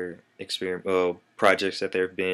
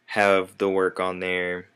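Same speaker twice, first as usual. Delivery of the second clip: ordinary talk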